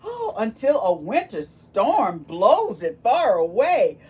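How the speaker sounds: loud, excited reveal; dramatic reveal. Acted. Teacher revealing the storm to the children, loudly excited. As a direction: announce the storm with big drama.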